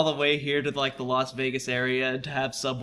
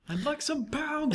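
silly high voice; 12 semitones higher, 6 dB quieter than usual. Pretend. Silly quoted voice.

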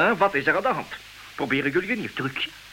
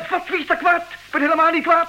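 much higher, louder furious denial; angry denial; 11 semitones higher, 4 dB louder than usual. Acted. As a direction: insist you're calm while fuming. Angrily denies being angry.

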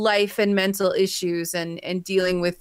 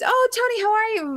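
much higher, sing-song "Oh, Tony"; gushing greeting; 13 semitones higher, much faster than usual. Retold or imitated. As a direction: greet him like an old friend, bright and high. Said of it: "And I was".

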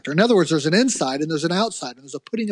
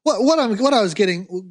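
ordinary talk, nothing marked

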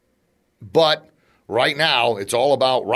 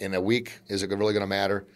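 ordinary delivery

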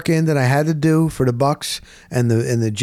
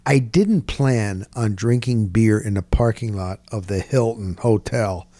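usual speaking voice

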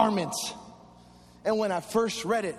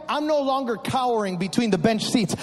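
normal talking voice

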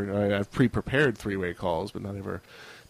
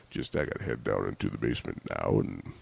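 much lower, husky sick voice; 13 semitones lower, 8 dB quieter than usual. Pretend. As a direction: put on a deep croaky sick voice. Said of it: "power of my, uh, sick voice".

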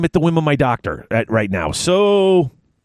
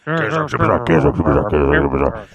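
each "Chaos Orc Superstore" repeated lower and fading like an echo; 13 semitones lower, faster than usual. Shown as a demonstration. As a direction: echo the name into the distance. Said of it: "repeats the sponsor name".

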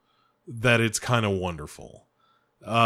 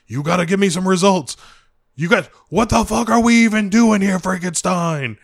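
higher, louder barking officer; military dude shouting; 9 semitones higher, 7 dB louder than usual. Retold or imitated. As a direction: yell at him like an impatient army officer demanding results. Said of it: "there's a military dude that's like".

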